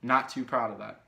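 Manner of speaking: ordinary talk, nothing marked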